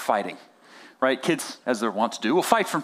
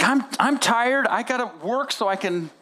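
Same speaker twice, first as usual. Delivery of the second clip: wrecking-ball parent rant; 7 semitones higher than usual. Acted. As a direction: plead and scold like a worn-out parent, acted. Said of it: "that's the wrecking ball approach".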